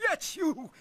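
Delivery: high, sniffly sneeze; a small sniffling sneeze. Acted. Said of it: "sniffled Brad".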